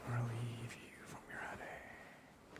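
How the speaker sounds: slow, soft reassuring whisper; tender ASMR comfort; soothing whisper. Real, not acted. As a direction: ease their headache slowly.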